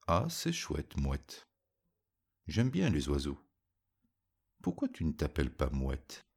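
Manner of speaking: plain reading voice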